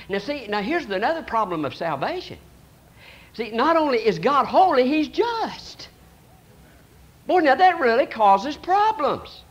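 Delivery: usual speaking voice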